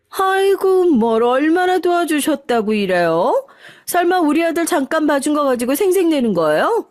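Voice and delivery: mock her favours; sarcastic mockery. A narrator's reading. Sister-in-law sneering at her elder sister-in-law's help, sarcastic.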